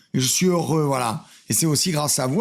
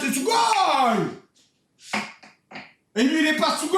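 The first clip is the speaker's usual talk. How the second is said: excited shout; 5 semitones higher than usual. Real, not acted. Shouting about studio.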